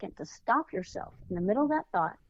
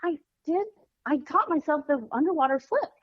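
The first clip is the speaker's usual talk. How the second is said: put-on high, proud little girl bragging; excited child; 7 semitones higher than usual. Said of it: "all excited".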